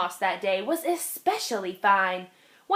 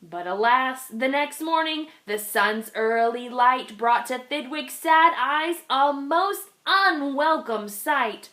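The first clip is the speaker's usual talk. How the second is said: ordinary delivery, nothing marked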